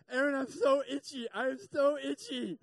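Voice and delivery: itching all over and suffering; miserable whine. Retold or imitated. A husband in an allergic reaction whining to his wife.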